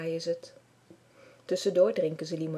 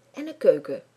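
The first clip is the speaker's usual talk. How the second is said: called out; 7 dB louder than usual. A narrator's reading. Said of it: "roept Lisanne".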